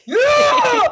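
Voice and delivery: high, loud gleeful yell; excited shout. Real, not acted. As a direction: whoop with joy. Thrilled to get the battery.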